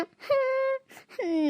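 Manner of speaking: long high whimpering sob; drawn-out sob. Acted. Wife kicked by the cow breaks into a long high sob. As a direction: let a slow, high wail break out.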